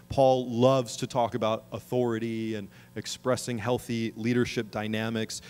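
usual speaking voice

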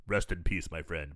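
low, soft mock solemn farewell. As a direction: send him off gravely. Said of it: "And he's like".

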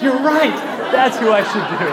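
high, loud burst of mock revelation; hammed-up delighted agreement; excited realisation. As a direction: exclaim it as a sudden epiphany. Acts out his delighted realisation.

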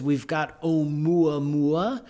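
Oumuamua said slowly, savouring each vowel, oh-moo-ah-moo-ah; lingering fondly over Oumuamua, oh-moo-ah-moo-ah; relishing the word. Shown as a demonstration. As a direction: Oumuamua drawn out as a treat to pronounce. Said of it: "which I love saying".

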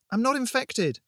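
high-pitched urgent plea; desperate cry. A narrator's reading. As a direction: cry it out, pleading for your life. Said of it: "I cried".

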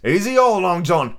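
high-pitched sailor's protest; protesting cry. A narrator's reading. Israel tells Long John to go easy, crying out. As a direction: cry out to calm the captain down. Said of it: "cried Israel".

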